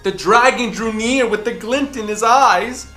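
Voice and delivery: ordinary delivery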